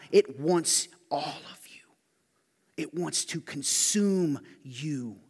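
unvoiced menacing whisper, slow; preacher whispering the danger of sin; creepy whisper. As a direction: whisper it like a lurking threat. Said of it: "creepy whisper about sin".

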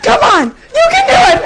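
loud, high shout with a laugh; the man joins in yelling, laughing. Retold or imitated. Runner she passed joins in, shouting and laughing. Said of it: "so he goes".

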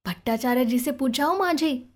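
ordinary delivery

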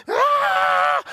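high-pitched mock scream. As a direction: cry out like panicked parents on a plane. Acting friends' shock on plane.

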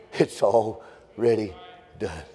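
"already ... done" drawn out, with a pause before "done"; slow, deliberate emphasis. Real, not acted. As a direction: let "already" stretch, then set "done" apart. Repeats it slowly for emphasis.

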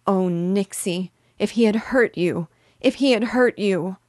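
loud, high tearful cry; crying aloud. A narrator's reading. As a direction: cry it out, overwhelmed with fear. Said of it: "kissing her and crying aloud".

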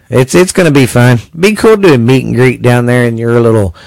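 normal talking voice

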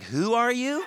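high, lightly voiced startled girl; bewildered checkout girl. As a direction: ask it in comic alarm. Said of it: "the poor checkout girl's like".

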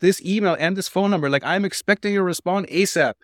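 high, insistent complaining customer; demanding customer voice. Retold or imitated.

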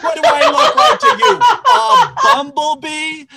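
loud, high, laughing rant; mock outraged shout. Customer ranting at the sandwich guy, mock outraged shout. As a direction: yell it as if insulted, hammed-up.

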